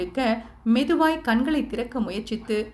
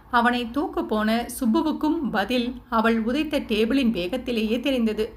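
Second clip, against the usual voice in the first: usual speaking voice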